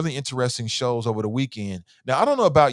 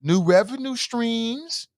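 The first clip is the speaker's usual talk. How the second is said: dreamy; 13 semitones higher, slower than usual. Retold or imitated. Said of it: "You're like".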